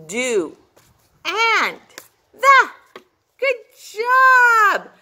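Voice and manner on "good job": praise shout. Real, not acted. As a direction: cheer them on. Praising children loudly.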